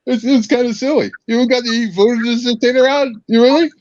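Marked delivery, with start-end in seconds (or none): none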